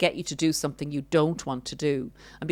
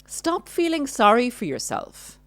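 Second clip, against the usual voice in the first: higher, sharper dismissive scolding; dismissive narcissist; 8 semitones higher, 3 dB louder than usual. Retold or imitated. Narcissist dismissing crying partner, higher scornful rebuke. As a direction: brush off their tears with contempt. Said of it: "the narcissist will say this".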